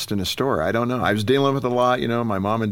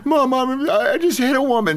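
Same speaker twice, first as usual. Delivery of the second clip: put-on upset little boy; 4 dB louder than usual. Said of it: "I'm in tears. I'm going".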